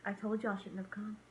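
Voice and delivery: a ghostly mom on the phone, quietly grumbling; mom's quiet grumble. Retold or imitated. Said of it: "She's like".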